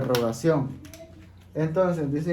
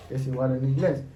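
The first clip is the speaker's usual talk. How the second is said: ordinary delivery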